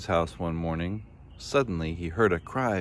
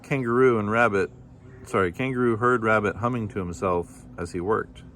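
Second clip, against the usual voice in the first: ordinary delivery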